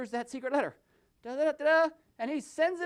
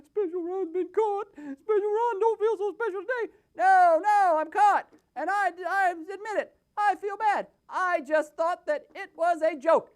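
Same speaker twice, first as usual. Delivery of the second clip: higher, put-on voice of a caught crook; caught puppet voice; 7 semitones higher, 5 dB louder than usual. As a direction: play the guilty puppet, whining. Special Ron puppet confesses.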